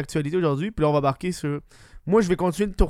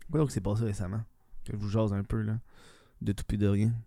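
low, smooth late-night radio host; sultry radio voice; 5 semitones lower, 6 dB quieter than usual. Pretend. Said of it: "ma voix suave de radio, de radioteur".

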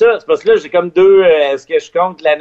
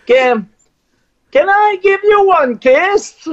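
much higher and slower, shy and cheeky; high, slow, coy English; 14 semitones higher, slower than usual. Retold or imitated. On air, he coyly asks the Spice Girl for a kiss. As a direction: sneak the kiss request in timidly.

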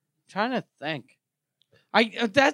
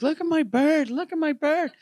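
higher, proud mock girl voice; eager girl; 9 semitones higher than usual. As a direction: show off your pet bird brightly. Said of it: "she was like".

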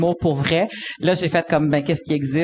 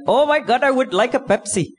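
customer voice; 7 semitones higher than usual. Retold or imitated. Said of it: "il a fait comme".

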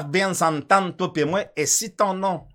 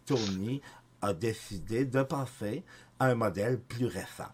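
Céline voice; 5 semitones lower than usual. Acted. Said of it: "la météo avec, euh, Céline".

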